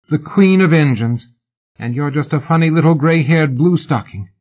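woman taunting the famous lady; mocking fan. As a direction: needle her with fake flattery.

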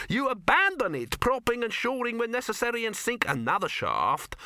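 high-voiced pedant unrolling expertise; expansive dwarf. A narrator's reading. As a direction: settle into a long technical explanation. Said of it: "settle down to a good long explanation".

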